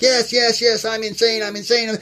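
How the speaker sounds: high, loud mock-exasperated yes yes; sarcastic agreeing. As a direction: give in loudly just to end the fight. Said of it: "And I'm like".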